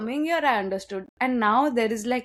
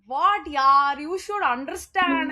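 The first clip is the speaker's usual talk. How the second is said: put-on higher-pitched South Indian English accent; imitated South Indian English lilt, pitched up; 6 semitones higher, 3 dB louder, slower than usual. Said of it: "I get a South Indian English accent".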